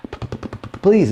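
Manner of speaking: stuck on the P, then blurt it out; stammering patient. Acted. Patient pleads with the doctor, stammering. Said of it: "with a speech impediment".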